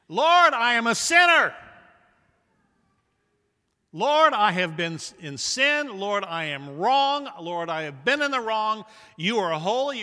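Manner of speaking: loud, high pleading prayer to the Lord; fervent prayer. Acted. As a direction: cry out the confession like a sinner begging. Preacher voices a confession prayer.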